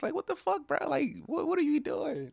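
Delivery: high-pitched what are you doing outburst; exasperated disbelief. Real, not acted. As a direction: throw up your hands at them in disbelief. Ranting about people filming shootings.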